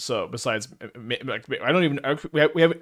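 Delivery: tripping over false starts; real stutter, words breaking off unfinished. Real, not acted. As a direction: stumble and restart the sentence repeatedly. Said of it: "I'm stuttering so much today".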